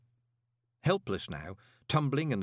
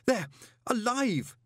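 high, breathless horror; gasping in panic; gasped; 13 semitones higher, 3 dB louder, slower than usual. A narrator's reading. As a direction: blurt it out in shock. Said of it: "he gasped".

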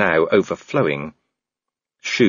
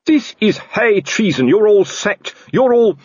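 loud, much higher furious sacking; enraged yelling; 13 semitones higher, 5 dB louder than usual. Acted. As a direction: scream them all out of a job. Colon enraged, face crimson.

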